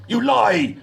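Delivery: high, forceful accusing roar. A narrator's reading. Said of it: "roared his interrogator".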